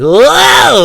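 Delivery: high, loud startled whoa; falling whoa. Retold or imitated. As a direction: yelp like you're toppling over. Imitates someone falling.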